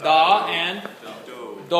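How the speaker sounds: DA and DO each drawn out slowly and hit hard; stressed syllables. Shown as a demonstration. Choir director showing which syllables to stress, strong stress on DA and DO. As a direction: punch "Da" and "do", slowly. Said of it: "two stress syllables that you need to concentrate on".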